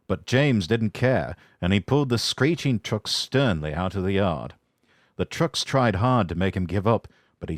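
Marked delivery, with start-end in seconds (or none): none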